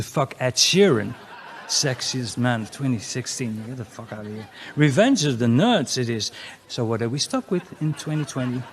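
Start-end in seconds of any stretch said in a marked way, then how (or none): none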